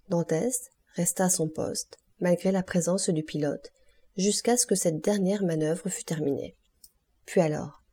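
usual speaking voice

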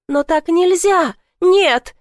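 high, loud frightened cry; panicked protest. A narrator's reading. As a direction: cry out in alarm.

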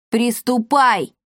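mild, drawn-out shout of command; sharp order. A narrator's reading. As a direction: urge the prisoner to get on with it. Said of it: "прикрикнул на него Пима". (строгий протяжный окрик)

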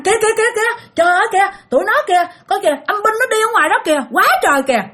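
very high, rapid-fire mimicked alarm; alarmed crying out. Possessed mother points at ghost soldiers outside, crying out in alarm. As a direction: point out danger in a high excited cry. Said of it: "nói rằng".

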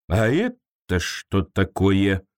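slow, low, pondering voice; low creature voice wondering aloud; thoughtful creature. A narrator's reading. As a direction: muse it slowly like a puzzled creature. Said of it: "задумчиво произнесло оно".

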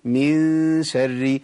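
"من شر" with a long sustained hum on the ن; ikhfa demonstration. Shown as a demonstration. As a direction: stretch the ن of "من" slowly, then glide into "شر". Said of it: "أقولها إزاي بقى الإخفاء". (إخفاء النون بغنة طويلة في "من شر")

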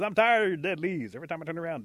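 high, loud fed-up outburst; exasperated. Acted. Rabbit tired of Possum's dead leaves, exasperated. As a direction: burst out, sick of hearing it.

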